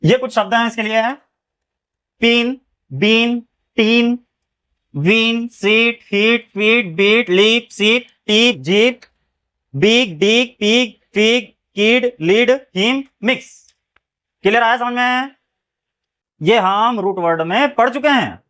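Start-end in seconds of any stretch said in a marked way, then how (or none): 2.2-13.5 word-list reading. Shown as a demonstration. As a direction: read each word out slowly and clearly. Reads word list aloud.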